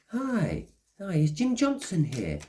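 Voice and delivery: high, cheery voice of a stranger at the door. Retold or imitated. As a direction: chirp it up like a polite stranger asking at the door.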